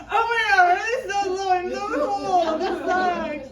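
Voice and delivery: hammed-up freezing whine; whiny mock cry. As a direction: wail about the cold seat. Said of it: "I'm like".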